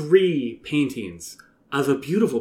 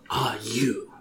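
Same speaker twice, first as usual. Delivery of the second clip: whispered; 3 semitones lower, 7 dB quieter than usual. Acted. Queen suspicious.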